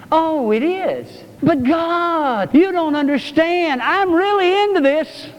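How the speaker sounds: a petulant believer arguing his case; whiny protest to God. Acted.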